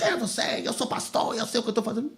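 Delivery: high-pitched mock indignation; retold indignant retort. Pastor imagines snapping back at the brother, mock indignant.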